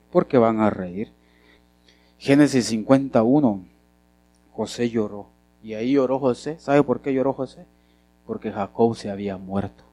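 normal talking voice

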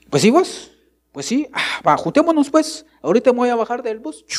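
voz mucho más aguda y rápida de muchacha; hija adolescente. Retold or imitated. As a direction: imita a la patoja hablando con su amigo. La hija responde al amigo. (la hija platica con un amigo en el bus, voz aguda y apurada)

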